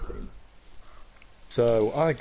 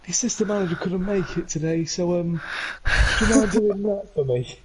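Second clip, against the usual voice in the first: pleading coach; 9 semitones higher than usual. Retold or imitated. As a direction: ask the favour sheepishly, pitched up. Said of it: "And I was like".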